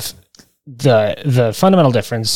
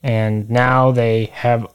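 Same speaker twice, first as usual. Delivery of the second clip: usual speaking voice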